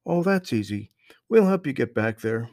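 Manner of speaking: put-on high, loud friendly boy; young boy voice. A narrator's reading. Strange boy greets the lost children, high friendly voice. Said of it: "replied Marvin".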